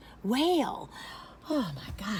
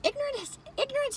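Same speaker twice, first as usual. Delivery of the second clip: "Ignorance" tossed up much higher, quiet; questioning the word; 5 dB quieter than usual. Shown as a demonstration. Weighing a touchy word, said high and wondering. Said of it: "like it's a bad word".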